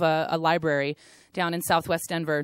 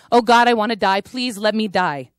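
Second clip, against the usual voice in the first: anguished cry; 3 semitones higher, 7 dB louder than usual. Retold or imitated. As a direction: cry it out in grief. Grieving girl's cry quoted.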